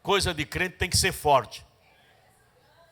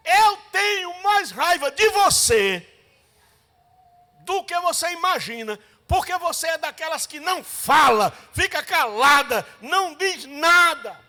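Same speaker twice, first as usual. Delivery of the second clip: furious shouting; 8 semitones higher, 6 dB louder than usual. Retold or imitated. Raging woman screaming at the silent sister. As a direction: scream your hatred at her. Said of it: "gritando assim".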